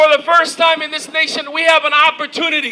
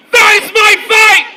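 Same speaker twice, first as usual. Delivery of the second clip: higher, louder rallying shout; with conviction; 6 semitones higher, 6 dB louder than usual. Shown as a demonstration. Speaker leading the rally crowd in a chant, shouted with conviction. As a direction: belt it out like a vow to the crowd. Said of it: "Say it with conviction".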